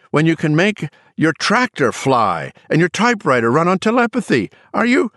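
high, loud exasperated rant; screamed outburst. A narrator's reading. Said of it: "struck his temples with his fists and screamed".

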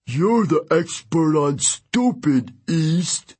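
slow, scoffing river spirit. Acted. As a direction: scoff at your rival.

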